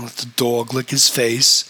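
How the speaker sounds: usual speaking voice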